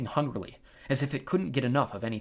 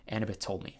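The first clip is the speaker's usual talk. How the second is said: ordinary delivery, nothing marked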